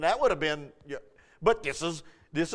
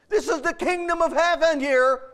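ordinary delivery

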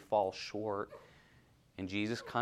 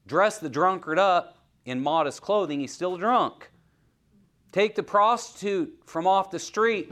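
higher, louder fiery preaching; preaching at full force; 8 semitones higher, 9 dB louder than usual. Acted. Preacher thundering at congregation about the heart, full force. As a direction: drive it home to the whole church.

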